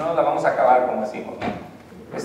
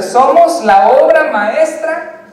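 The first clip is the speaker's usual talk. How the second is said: much higher, louder, very slow; proclaiming; 9 semitones higher, 8 dB louder, much slower than usual. Acted. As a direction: declare it grandly, word by word. Preacher proclaims verse.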